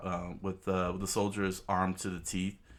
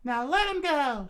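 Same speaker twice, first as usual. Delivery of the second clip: screamed order, much higher; high loud demand; 6 dB louder than usual. Acted. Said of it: "she screamed out".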